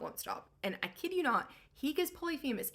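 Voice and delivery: normal talking voice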